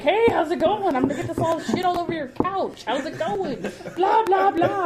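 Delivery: high, loud, oblivious babble; hammed-up clueless friend, laughing; Bob. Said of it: "it's like Bob walking in".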